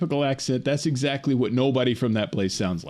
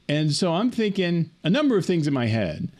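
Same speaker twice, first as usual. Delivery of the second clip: usual speaking voice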